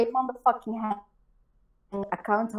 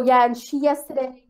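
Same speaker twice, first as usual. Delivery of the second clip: ordinary delivery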